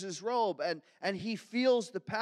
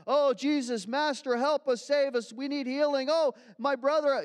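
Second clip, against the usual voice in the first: louder, higher desperate cries for help; crowd crying out; 7 semitones higher, 6 dB louder than usual. Acted. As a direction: call out like a pleading crowd.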